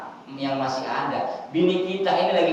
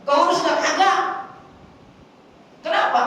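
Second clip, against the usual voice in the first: raised question and answer; 11 semitones higher than usual. Real, not acted. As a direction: fire the question and answer it yourself. Preacher asks and answers loudly. (pertanyaan retoris keras dan tinggi)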